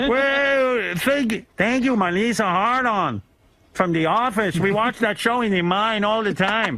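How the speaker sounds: high, slow mock Chilean accent; pleading miner in a hammy Chilean accent. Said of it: "Everybody sounds like they're from the Middle East".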